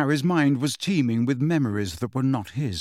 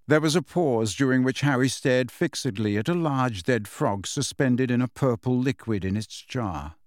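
ordinary delivery

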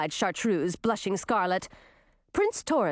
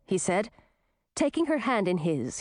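ordinary delivery

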